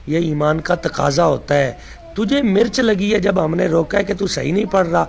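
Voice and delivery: ordinary talk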